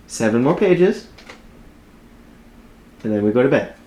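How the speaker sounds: high, cooing sing-song to a puppy. Real, not acted. Talks to her dog mid-reading.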